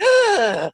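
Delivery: loud, high gasp for air; sharp gasp. Retold or imitated. Convulsing in meditation, a sharp gasp for air. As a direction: gulp a breath in shock. Trying to breathe through convulsing.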